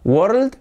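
WORLD said quickly as one syllable, rising; questioning, too-simple WORLD; mispronounced world. Shown as a demonstration. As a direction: say WORLD without the dark rolled-out L. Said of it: "No. ¿Cómo se pronuncia esto?".

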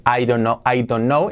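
"I don't know" with "don't" sounded separately; each word of "I don't know" on its own; unlinked words. Shown as a demonstration. Teacher shows what not to say, unlinked "I don't know". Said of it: "En lugar de decir".

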